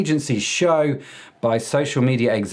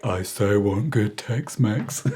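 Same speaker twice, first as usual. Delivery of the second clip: slow, lightly voiced longing plea; a hint of a homesick colleague craving food; imitating Anna Grace; 3 semitones lower, slower than usual. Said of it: "Anna Grace was like".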